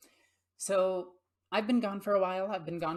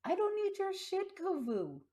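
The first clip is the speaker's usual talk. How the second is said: much higher sassy pet voice; mock cat sass; 14 semitones higher than usual. Real, not acted. Bickering with her cat Kovu, high sassy voice. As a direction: sass the cat back playfully.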